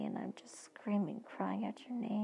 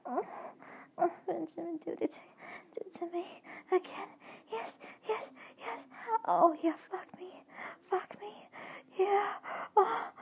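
much higher, louder ecstatic cries building to a peak; crying out; 9 semitones higher, 6 dB louder than usual. Acted. Woman crying out to Benjamin, climbing to loud frantic peaks. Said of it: "I'm just crying out".

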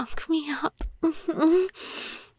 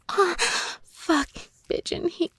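ordinary talk

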